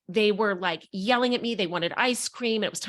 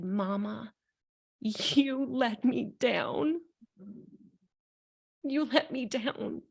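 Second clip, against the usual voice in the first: put-on tearful child; 3 semitones higher, much slower than usual. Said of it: "Her little finger was shaking".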